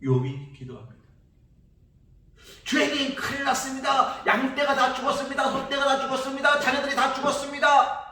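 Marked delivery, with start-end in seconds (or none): 2.6-8.0 much higher, loud lament; anguished cry. Acted. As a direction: wail out each loss to God. Job crying out over losses. (욥이 하나님께 울부짖듯)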